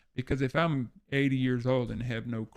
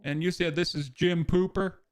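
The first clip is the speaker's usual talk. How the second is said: naive caller voice; 3 semitones higher than usual. Acted. Plays Robert Schneider to scammer.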